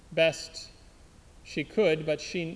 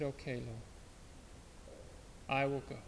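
hushed, much softer yielding; quiet surrender; 5 semitones lower, 12 dB quieter than usual. Acted. Anna Maria quietly agrees to God. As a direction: give in gently, almost inaudible.

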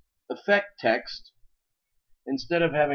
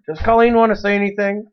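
loud, raised voice across the room; calling out; 9 semitones higher, 11 dB louder than usual. Real, not acted. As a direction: call out to someone in the doorway. Calling over to Colleen arriving.

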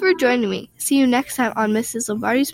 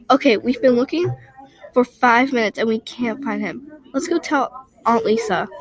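ordinary delivery

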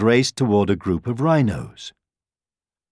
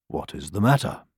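lower, softer question; wise lion; 5 semitones lower, 5 dB quieter than usual. A narrator's reading. Lion to the halted animals, calm and low. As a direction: ask it low and calmly.

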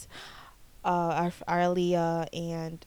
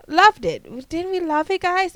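excited; 9 semitones higher, 9 dB louder than usual. Real, not acted. Nostalgic burst about nineties shows.

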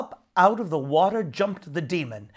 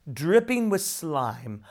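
usual speaking voice

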